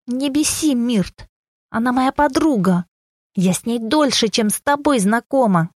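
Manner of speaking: high-pitched indignation without shouting; infuriated. A narrator's reading. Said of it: "взбешённую девушку". (возмущённо, высоким голосом)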